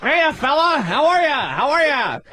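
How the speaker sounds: high, singsong hammed-up greetings. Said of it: "stick up his ass walk".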